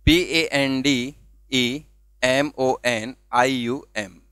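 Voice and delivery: letter by letter, slow and even; spelled out slowly. Shown as a demonstration. Teacher spelling the word slowly for the class. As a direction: spell each letter for the students. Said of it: "मैंने शब्द के टुकड़े किए".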